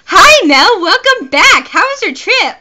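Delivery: loud, high, drawn-out greeting; over-the-top high game character. Acted. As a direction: sing out the greeting in a high, swooping voice. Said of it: "You gave him the best voice".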